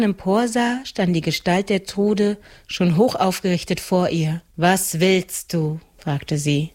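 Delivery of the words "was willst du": slow, drowsy question; slow waking voice. A narrator's reading. Rain woman wakes and asks the girl, slowly. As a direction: ask slowly as if just woken.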